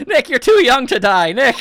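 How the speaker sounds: high, loud cry for a friend; hammed-up panicked lament; distraught wail. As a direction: cry out in acted despair. Thinks friend is being killed.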